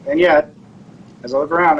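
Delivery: ordinary talk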